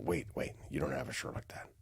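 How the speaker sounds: soft whisper to himself; whispered realization. Retold or imitated. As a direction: whisper as you catch your own mistake. Dreamer catches himself, retold.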